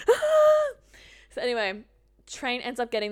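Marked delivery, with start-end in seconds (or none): 0.1-0.7 high loud shriek of alarm; retold scream, high and loud; mock panic scream. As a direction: let out a sudden panicked squeal. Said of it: "and I was like".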